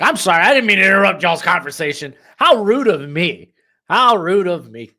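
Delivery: loud, high, over-the-top sorry; loud theatrical self-reproach; mock apology. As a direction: apologize with exaggerated indignant politeness. Mock apology for interrupting others' conversation.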